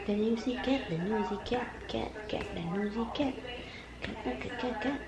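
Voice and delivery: quiet sing-song teasing; hushed playful cooing; soft cooing to cat. Real, not acted. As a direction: murmur softly to the pet.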